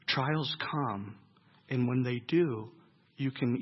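gentle sermon hush; soft preaching. Acted. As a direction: draw the listeners in close and quiet. Soft intimate preaching passage.

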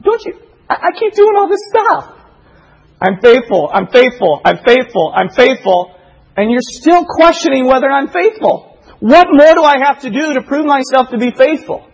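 high, pleading outburst of exasperation; exasperated God. Acted. God protests to doubting Israel that he is faithful, exasperated. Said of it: "why is God so furious?".

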